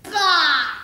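put-on a loud, explosive old miser's "Bah!"; Scrooge's scoff. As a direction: spit it out with contempt.

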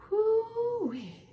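high, loud whoop of relief; joyful whoop. A narrator's reading. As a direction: let out a delighted cheer. Rescued just in time.

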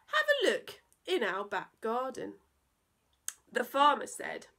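put-on lively rhyming read-aloud for children; playful children's story narration; storytelling voice. A narrator's reading. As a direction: bounce through the verse like a bedtime poem. Reads a silly poem to children.

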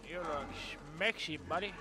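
hammed-up seductive drawl, slow; mock flirty. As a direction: flirt like a cheesy lover. Flirting with creepy picture.

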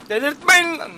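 high, very loud outburst; furious shout. Real, not acted. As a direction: explode in exasperation. Oyun onu çileden çıkarıyor. (çileden çıkmış, bağırarak)

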